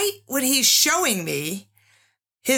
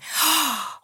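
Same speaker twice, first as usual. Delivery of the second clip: high, sudden intake of breath; a startled little gasp; sharp gasp; 6 semitones higher than usual. Retold or imitated. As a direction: gasp at the realization. Remembering realizing in the dream.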